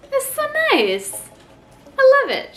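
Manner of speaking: high, delighted gush over a handmade gift; delighted squeal. Real, not acted. Showing mom's handmade gift.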